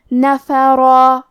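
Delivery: نفرا chanted slowly, with both fathas equal; even fatha demo. Shown as a demonstration. Teacher demonstrates even vowels, slow chant. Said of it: "برابر سے پڑھیں".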